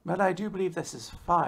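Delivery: distinguished gentleman put-on; Clothy. Collar face giving Andy advice, mock-distinguished voice. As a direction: say it as a very refined little advisor. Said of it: "like, distinguistic voice".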